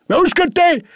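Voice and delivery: high, loud demand; shouted question. Acted. Old master yells up to his maid, loud call. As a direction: yell up the stairs.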